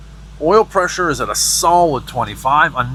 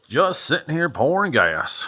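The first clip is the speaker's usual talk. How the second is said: put-on terrible southern accent; 4 semitones higher than usual. Musing aloud while pouring gas, terrible southern accent. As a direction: put on a bad country twang, Southern US. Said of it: "terrible southern accent with gas".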